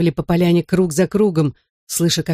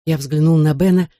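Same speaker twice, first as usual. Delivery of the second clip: ordinary delivery, nothing marked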